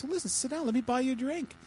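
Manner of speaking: lightly acted eager barfly; eager invitation. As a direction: play an eager fellow offering a drink. Joke character invites Jesus over.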